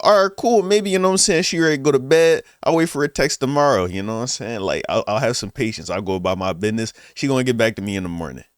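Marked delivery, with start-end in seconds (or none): 0.0-3.9 high, loud upbeat self-talk; hopeful inner voice. Retold or imitated. A guy hyping himself up after she stops texting, upbeat. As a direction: talk yourself into optimism. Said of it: "I thought".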